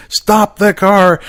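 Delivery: high, loud, drawn-out yell; screaming. Retold or imitated. Dad screaming at daughter driving off, loud slow yell. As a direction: holler it after the runaway car. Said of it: "I'm screaming".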